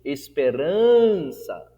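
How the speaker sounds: Esperança slowed, RAN loud and long; Esperança with a stretched, punched second-to-last syllable; stress on RAN. Shown as a demonstration. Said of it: "no ran".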